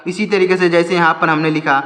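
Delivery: usual speaking voice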